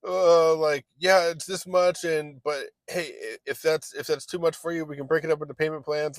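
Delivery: nervously oversell the payment plan; desperate salesman. Retold or imitated. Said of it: "you can smell my desperation".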